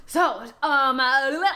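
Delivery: usual speaking voice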